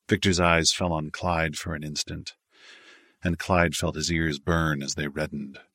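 ordinary delivery, nothing marked

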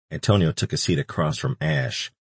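ordinary delivery

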